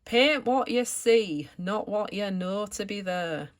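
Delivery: loud, hammed-up Lancashire accent; terrible Lancashire accent. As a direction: do a bad Lancashire art teacher, projecting firmly. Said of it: "I'm gonna do a terrible accent here, he was from Lancashire".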